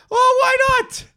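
high squealed why not; high mock squeal. Mocking the sale idea.

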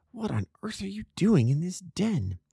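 ordinary delivery

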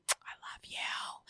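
breathy stalker whisper; creepy whisper. Acted. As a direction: whisper it close and eerie. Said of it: "That sounded creepy".

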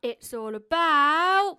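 slow teasing build-up; drawn-out dramatic pause. Shown as a demonstration. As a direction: stretch it out for suspense. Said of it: "come on, I haven't got all day".

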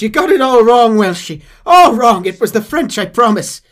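high, loud pleading Irish voice; Irish accent. Retold or imitated. Irishman pleading with his captors, Irish accent. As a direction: beg in a comic Irish accent. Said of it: "Ira says".

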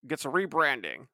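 hint of a TV anchor; slow, lightly voiced news read. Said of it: "use your best T V news anchor voice".

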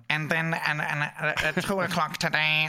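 droning monotone buzz, slurring into nonsense; wasp-like newsreader. Retold or imitated. Mocking radio newsreaders, droning like wasps. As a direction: drone the news like a buzzing wasp. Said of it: "some of the newsreaders are like wasps".

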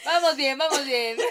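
laughing. Real, not acted. Laughing praise of friend.

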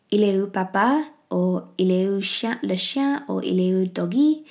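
put-on lightly English-tinged French, called out to the baby; bad French accent. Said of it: "sorry about my really bad French accent".

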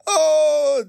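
high loud laughing oh; loud laughing cry. Real, not acted. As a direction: shout it laughing.

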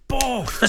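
high punchy boff for the crunching tackle; tackle sound effect. Retold or imitated. Ray telling the trial story, high punchy tackle sound. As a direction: smack it out like a hard challenge.

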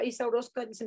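ordinary talk

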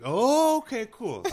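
high surprised "Oh", then deflating. Retold or imitated. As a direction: caught off guard, quick recovery. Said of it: "And I was like".

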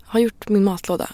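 hushed note to self; inner thought. Retold or imitated. Reader remembering chores mid-book, quiet inner voice. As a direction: think aloud, softly.

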